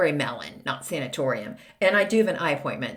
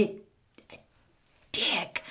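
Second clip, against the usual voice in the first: whispered swear; 9 dB quieter, much slower than usual. Real, not acted. Host censoring her own swear word with a whisper.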